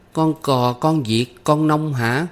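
put-on high child voice, drawn out; young girl's high questioning voice; guessing little girl. A narrator's reading. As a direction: try another guess as a small pupil. Child guesses another rhyme.

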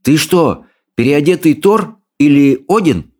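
put-on boy's voice. Boy questions the dragon.

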